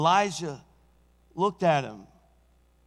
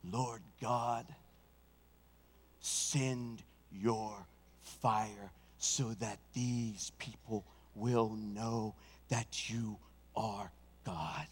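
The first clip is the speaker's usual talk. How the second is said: slow, soft, low prayer; hushed prayer; 8 semitones lower, 10 dB quieter, much slower than usual. Acted. Elijah praying to God, slow and soft. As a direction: pray it slow and close.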